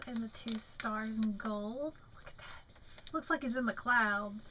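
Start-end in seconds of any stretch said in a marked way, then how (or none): 2.1-3.1 whisper. Real, not acted. Showing craft mail to camera.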